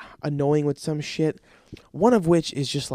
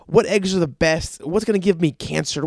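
ordinary delivery

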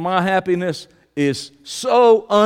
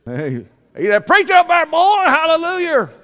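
high, loud Southern church holler; hammed-up Southern holler; 9 semitones higher, 4 dB louder than usual. Hams up the Southern accent he loves.

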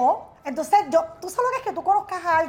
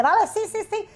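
eager yes; 8 semitones higher, 3 dB louder than usual. Retold or imitated. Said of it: "yo le dije".

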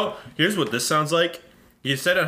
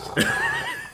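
high squealing laugh; 4 dB quieter than usual. Real, not acted. Cohost squeals with laughter at the joke.

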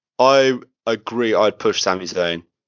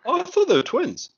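much higher-pitched surprise; surprised realization. Real, not acted. Host caught out on the brothers being twins, surprised. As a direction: blurt it out high in disbelief. Learns they are not twins.

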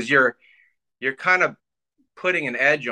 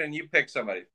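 ordinary talk

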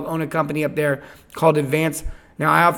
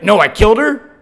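very confident; 4 semitones higher, 7 dB louder than usual. Retold or imitated. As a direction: imitate a dead-certain confession. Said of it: "they look very confident".

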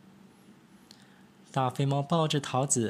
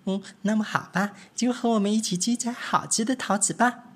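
high, lively little mouse voices; little mice; 9 semitones higher than usual. Acted. Three little mice chattering to each other, high and eager.

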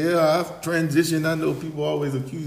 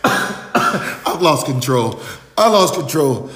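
laughing, high and loud, I've lost it; laughing despair; 8 semitones higher, 8 dB louder, slower than usual. Real, not acted. Host cracking up at his guest's joke, laughing. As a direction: wail it through laughter.

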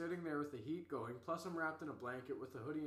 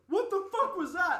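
high, loud, incredulous shout; shocked yell; 14 dB louder than usual. Retold or imitated. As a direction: imitate buddies yelling in disbelief. Voices everyone's shocked reaction.

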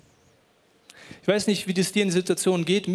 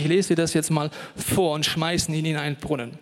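ordinary talk, nothing marked

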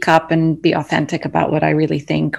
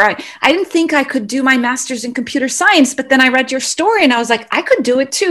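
higher, lightly voiced fan; a touch of a fan who heard her speak, voiced higher; quoted fan; 7 semitones higher than usual. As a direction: pitch it up as an admirer telling her about a talk. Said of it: "if somebody says".

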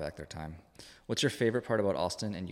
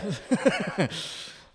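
breathy laugh; 13 semitones higher, 6 dB louder than usual. Real, not acted. Laughs at the joke.

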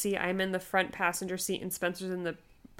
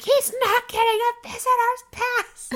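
very high, loud, slow yell; hammed-up indignant wail; screaming in labor; 7 dB louder, slower than usual. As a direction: re-enact screaming from a wheelchair. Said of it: "screaming in the wheelchair".

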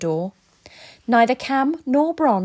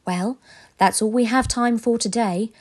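usual speaking voice

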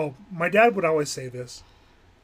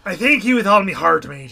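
much louder, quicker pirate echo; 10 dB louder than usual. Acted. Co-host echoing the pirate line back, loud pirate accent. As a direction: belt it out as a hammy pirate. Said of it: "I do love the pirate accent".

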